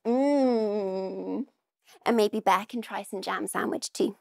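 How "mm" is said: a contented tasting hum; happy tummy-rubbing mmm; yummy mm. Shown as a demonstration. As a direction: hum as if the jam tastes delicious. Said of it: "rub your tummy and go".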